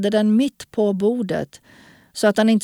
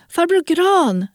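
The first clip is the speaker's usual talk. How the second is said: high-pitched call after him; girl calling out; 10 semitones higher, 4 dB louder than usual. A narrator's reading. Girl calls after the man walking off fast, high call. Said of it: "ropade rösten".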